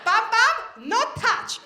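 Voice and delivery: high, loud imitation of an Italian-sounding toddler; toddler's Italian accent. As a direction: put on a little Italian child's voice. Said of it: "she sounded Italian".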